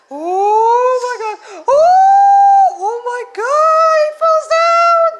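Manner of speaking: high, slow, loud cries of alarm; panicked high shouting at the screen; real shocked shout. Real, not acted. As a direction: yell it out as he falls. Shocked reaction to cartoon.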